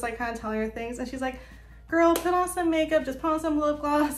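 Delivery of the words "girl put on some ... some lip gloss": higher-pitched imitation of an encouraging coworker; coworker's urging. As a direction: voice a friend's pep talk, pitched up. Coworker's pep talk retold.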